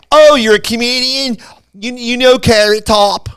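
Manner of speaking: loud, high Philadelphia hairdresser voice, drawn out; thick Philly accent. Retold or imitated. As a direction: put on a heavy Philly accent. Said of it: "Imagine my accent on steroids".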